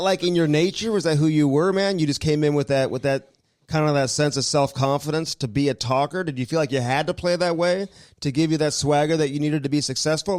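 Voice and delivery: ordinary talk, nothing marked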